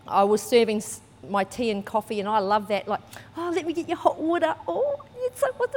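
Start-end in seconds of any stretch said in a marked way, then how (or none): 3.4-5.7 high, fast cooing hostess voice; a high, sugary tea-lady coo; sweet hostess. Retold or imitated. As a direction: gush over the hot water like an eager server. Serving tea, cooing at guests.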